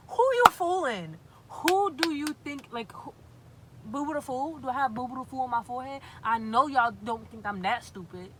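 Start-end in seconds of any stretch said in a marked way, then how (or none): 0.1-4.7 loud, high, disbelieving "who you fooling"; higher, louder exasperated disbelief; incredulous outburst. Real, not acted. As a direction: call out the obvious lie, loudly. Incredulous at being lied to.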